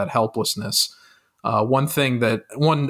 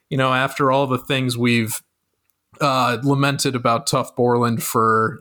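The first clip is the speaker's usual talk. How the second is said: ordinary talk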